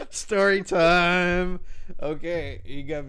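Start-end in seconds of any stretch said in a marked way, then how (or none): 0.0-1.6 slow, stretched story-time call; drawn-out announcement. Pretend. Friend opening his story for the group, drawn out. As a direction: stretch each word out like a show opener.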